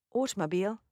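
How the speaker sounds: British AUTOMOBILE with a crisp T, ending "beel"; British accent. Shown as a demonstration. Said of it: "British accent".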